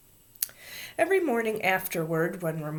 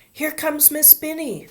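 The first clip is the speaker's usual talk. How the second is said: high-pitched warning call; 10 semitones higher, 4 dB louder than usual. A narrator's reading. Child warning the class of teacher, calling out. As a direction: call it out to the other kids. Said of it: "someone called out".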